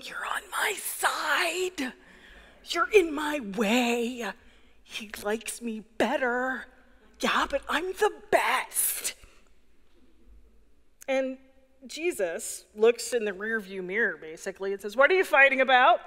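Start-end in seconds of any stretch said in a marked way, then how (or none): 0.0-9.1 hissed squabbling whisper shouts; bickering siblings whisper-yelling in the back seat. Retold or imitated. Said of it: "whisper yelling". 15.0-16.0 loud scolding. Retold or imitated. Jesus scolding from driver's seat.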